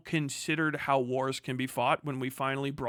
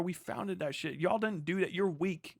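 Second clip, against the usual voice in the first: ordinary talk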